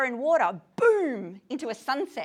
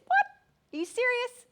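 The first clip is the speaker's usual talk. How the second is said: shocked disbelief; 13 semitones higher, 6 dB louder than usual. Retold or imitated. Shug reacting to Celie's disbelief in God, astonished. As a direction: pitch leaps way up in astonished disbelief. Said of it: "And Shug says".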